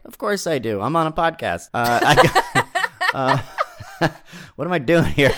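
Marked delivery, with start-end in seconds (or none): 2.7-4.0 laughing. Real, not acted. Laughing at herself on podcast.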